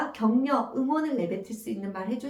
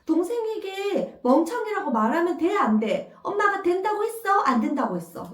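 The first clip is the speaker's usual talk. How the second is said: lightly voiced questioning mother; a hint of a mother quizzing her child; mother asking; 8 semitones higher than usual. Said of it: "이렇게 말씀을 하시는 거예요".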